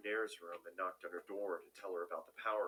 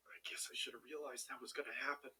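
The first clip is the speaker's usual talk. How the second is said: gagging mutter; 5 semitones higher than usual. A narrator's reading. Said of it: "I muttered to myself in between gags".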